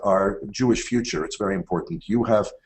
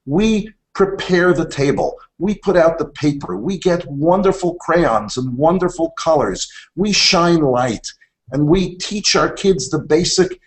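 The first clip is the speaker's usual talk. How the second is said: higher and louder, a rising preacher's build of inspiration; uplifting sermon close; 7 semitones higher, 4 dB louder than usual. Acted. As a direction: proclaim it like the finale of a sermon. Uplifting closing message to educators.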